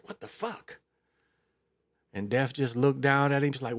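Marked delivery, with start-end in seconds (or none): 0.0-0.8 hushed, high-pitched startled reaction; startled king. Retold or imitated. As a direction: quietly voice a king caught off guard. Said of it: "the king was startled".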